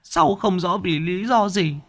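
high, thin, cowering ghost voice, stuttering; a frightened spirit begging the master; stammering ghost. Acted. Ghost answering the exorcist, high and stammering in fear. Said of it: "Nó lắp bắp".

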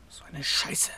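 frustrated whisper to himself; whispered curse. Acted. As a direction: hiss the curse under your breath. Alone after siblings leave.

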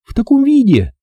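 indignant woman's exclamation. Acted. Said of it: "возмутилась она".

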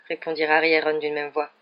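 ordinary delivery

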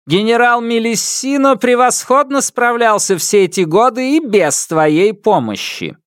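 put-on high, haughty old empress; contemptuous empress. A narrator's reading. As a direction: sneer it down imperiously. Empress derides.

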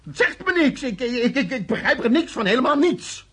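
high, loud desperate denial; frantic suspect denying everything to his interrogator; agitated protest. Acted. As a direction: plead your ignorance, voice climbing. Suspect protests accusations in panic.

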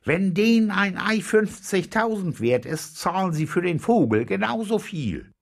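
high, loud, forceful argument; insistent, hard-headed. A narrator's reading. Captain insists the bird will fetch as much, insistent shout. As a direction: shout down all objections. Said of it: "der Käpt'n blieb hart".

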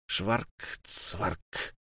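whispered eerie sound; whispered onomatopoeia. A narrator's reading. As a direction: breathe шварк, цварк as a whisper. Eerie sawing sound returns.

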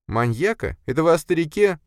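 very high questioning female-character voice; Sofia, surprised. Acted. Sofia questions boss about the old man, surprised.

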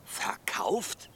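breathless whispered disbelief; the word catches in your throat; shocked whisper. Acted. Baumann hears that his voice was sold, in a stunned whisper.

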